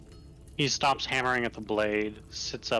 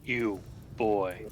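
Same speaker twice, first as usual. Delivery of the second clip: a hint of a deep giant voice; lightly voiced big smith calling out. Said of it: "a deep voice say".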